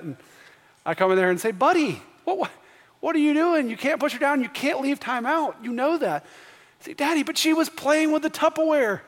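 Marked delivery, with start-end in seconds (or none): 1.5-6.3 higher-pitched, exasperated dad telling off his son; retold parental scolding. 6.9-9.0 put-on high-pitched little boy pleading his case; imitated child's protest. Son protesting to dad, retold.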